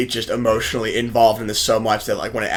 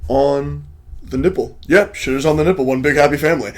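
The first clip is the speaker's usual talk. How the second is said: lightly imitated deadpan gamer, halting between words; a hint of a comic YouTuber commentator; Penguin Z zero; 3 semitones higher than usual. Host imitates a YouTuber naming Oregon Trail characters, light impression. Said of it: "he talks like this all the time".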